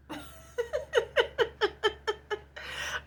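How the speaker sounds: high giggling laugh; helpless laughter; long high giggle. Real, not acted. Laughing at empress remark.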